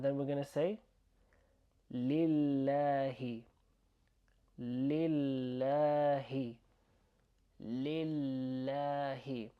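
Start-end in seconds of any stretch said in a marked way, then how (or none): 1.9-9.5 lillahi very slow, L held long. Shown as a demonstration. Teacher showing learners lillahi word by word, L held long. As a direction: stretch the double L in lillahi. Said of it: "kind of hold onto the L sound".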